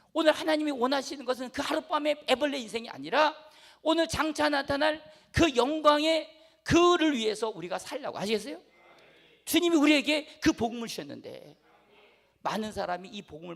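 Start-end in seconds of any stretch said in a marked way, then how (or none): 0.1-8.2 preaching. Acted. Preaching at full force.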